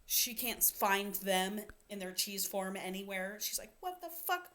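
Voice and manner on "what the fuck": high, strange mock squeal of disbelief; a hammed-up shocked squeak. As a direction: play her horrified discovery. Violet discovers cheese missing.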